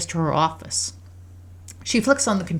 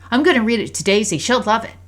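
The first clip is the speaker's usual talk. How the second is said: put-on high, quick, loud child; thrilled little-girl chatter; eager daughter; 6 semitones higher, 7 dB louder than usual. A narrator's reading. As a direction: rattle it off like an excited kid. Voices eager daughter making plans.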